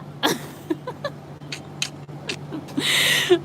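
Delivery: real breathy laugh. Real, not acted. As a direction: break into laughter. Laughing at slang.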